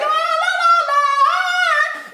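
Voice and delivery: very high mock horror-movie la-la; hammed-up high child singsong; creepy child imitation. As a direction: wail la-la like a spooky little child. Imitates creepy child outside the door.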